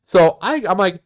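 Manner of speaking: ordinary talk, nothing marked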